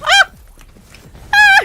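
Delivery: very high, loud yelp; startled scream. Real, not acted. Streamer caught in a gunfight, real startled scream.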